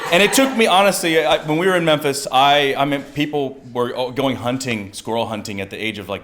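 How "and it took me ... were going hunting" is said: ordinary talk, nothing marked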